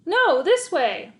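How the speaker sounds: loud, slow high shout; slow commanding yell. Acted. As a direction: call the dog back firmly. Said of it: "I shouted to Max".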